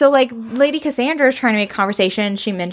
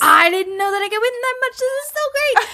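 put-on high, giddy voice of a thrilled girl; excited Mina; 14 semitones higher than usual. Mina thrilled at winning ten thousand pounds, high and giddy. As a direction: bubble over like she just won big. Retelling Mina's win at gaming.

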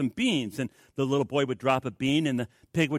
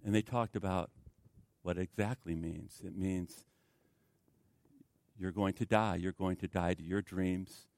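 soft preaching; 7 semitones lower, 10 dB quieter than usual. Acted.

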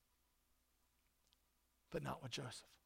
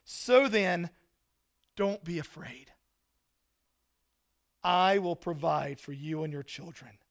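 Joseph speaking; 7 dB louder than usual. Acted. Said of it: "this is Joseph talking".

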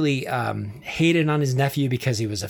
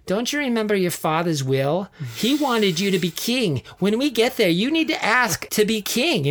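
nagging wife; 6 semitones higher than usual. Retold or imitated. Herodias urging her husband on the road to Rome, climbing pitch. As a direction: egg him on, climbing higher as she presses. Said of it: "Herodias is in his ear going".

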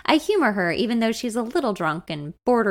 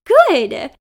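much louder, higher burst; excited; 5 semitones higher, 10 dB louder than usual. A narrator's reading. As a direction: blurt it out with delight, clapping. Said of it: "she becomes excited".

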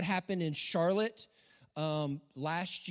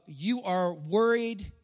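deliberate slow quote; 5 dB louder, much slower than usual. Acted. Quoting Jesus slowly.

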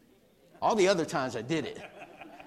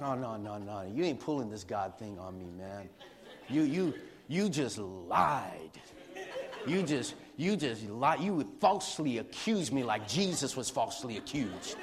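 defiant inner reply; 7 semitones lower, 5 dB quieter than usual. Retold or imitated. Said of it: "It's like".

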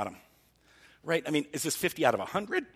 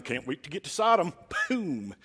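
eager sheriff; 3 semitones higher than usual. Retold or imitated. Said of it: "some sheriff going".

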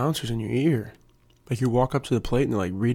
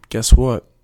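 much louder, mock-confrontational setup; punchy comeback; 12 dB louder than usual. Answering someone who called him lame, mock-confrontational. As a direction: throw it back loudly before the burn.

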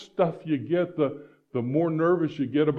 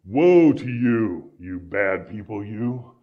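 drawn-out, louder mock condemnation; mock accusing woe; 3 semitones lower, 6 dB louder, slower than usual. Shown as a demonstration. Preacher mocking how we say woe as a curse. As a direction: lay on the finger-wagging doom. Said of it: "That's kind of our".

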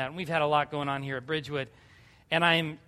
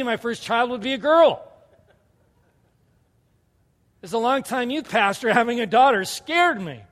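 raised, emphatic sermon voice; impassioned preaching; 5 semitones higher, 5 dB louder than usual. Real, not acted. Pastor testifying about having a daughter, impassioned preaching. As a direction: preach it out with rising fervour.

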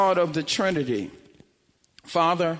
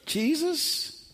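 soft, higher questioning call; tentative waking call; 6 semitones higher, 7 dB quieter than usual. Acted. Disciple downstairs waking Jesus, soft tentative call. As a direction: gently call to someone who is asleep.

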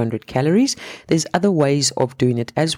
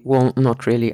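ordinary talk

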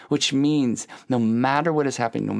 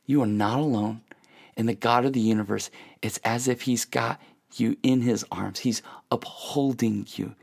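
ordinary talk

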